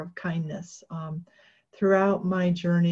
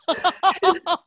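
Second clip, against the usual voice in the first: high, loud burst of laughter; surprised laugh; 10 semitones higher, 6 dB louder than usual. Real, not acted. Speaker laughing at getting a contract on the spot, high laugh. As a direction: laugh out in happy shock.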